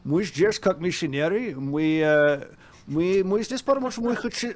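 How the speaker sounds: imitated Scottish accent in Russian; Russian with a put-on Scottish accent, faltering into hesitation; Sean Connery accent. Embarrassed missionary offering classes, imitated Scottish accent. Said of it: "cleared the Connery away".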